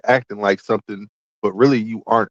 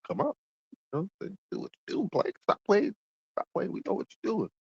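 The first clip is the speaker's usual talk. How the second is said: teasing taunt; 8 semitones higher, 10 dB quieter than usual. Real, not acted. Teasing high, hushed taunt.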